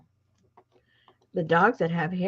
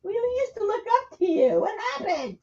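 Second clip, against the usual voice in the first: high teasing voice to the dog; talking to the dog; 13 semitones higher, 3 dB louder than usual. Acted.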